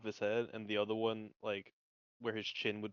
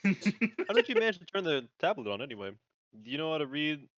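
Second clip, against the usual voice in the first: character voice; 5 semitones higher, 7 dB louder than usual. Acted. Character voice, louder, higher.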